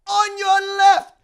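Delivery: high, loud, slow drawn-out echo; Falcon quote. Retold or imitated. Host repeating Falcon's line, drawn-out. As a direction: stretch it like a movie moment. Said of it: "I got you, Cap".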